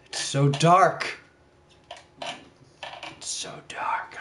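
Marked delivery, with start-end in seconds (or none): 3.2-4.2 slow, voiceless mock-dramatic whisper; playful hushed whisper. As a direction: whisper it slowly, as if lost in darkness. Acts out falling into darkness.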